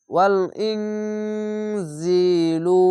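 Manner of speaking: ওয়াল ইনজিলু chanted slowly with nasal ng; slow recitation. Shown as a demonstration. Teacher recites the full phrase for students, slow nasal chant. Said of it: "ইকফা গুন্না বাংলা অনেস্কার মতো হবে".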